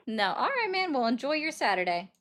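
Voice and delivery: normal talking voice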